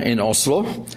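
ordinary talk

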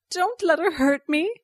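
high, loud pleading whine; whining. A narrator's reading. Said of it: "she whined".

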